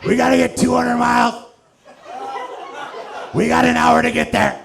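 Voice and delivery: high, loud meth-fuelled trucker; hammed-up high-pitched urgent shout; frantic trucker shouting. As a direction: yell it like a wired truck driver.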